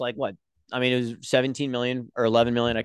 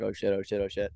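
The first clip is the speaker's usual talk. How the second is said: low, softer mock panic; imitating a team's hushed oh-no moment; 5 semitones lower, 8 dB quieter than usual. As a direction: quietly imitate someone caught out. Team panicking on radio.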